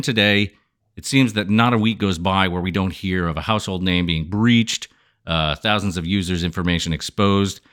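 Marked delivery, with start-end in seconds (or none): none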